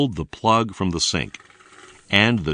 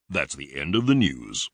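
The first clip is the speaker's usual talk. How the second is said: lower, softer newsreader sign-off; closing the broadcast like a TV anchor; news anchor; 4 semitones lower, 4 dB quieter than usual. Acted.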